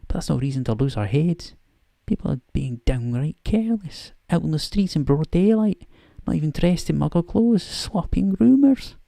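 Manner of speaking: put-on a slightly overdone Scottish accent, briskly; Scottish McGonagall. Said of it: "almost bad Scottish accent".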